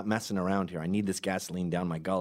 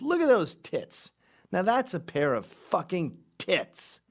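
mock crude boss; 9 semitones higher, slower than usual. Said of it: "walked in a room and said".

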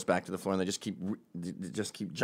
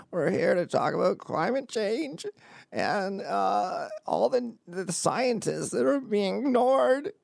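much higher, slow strained trembling voice; Robert F. Kennedy impression; 10 semitones higher, slower than usual. Kennedy giving a climate speech, strained and quavering. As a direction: sound like you're about to cry mid-speech. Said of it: "this vocal disease where it sounds like he's dying".

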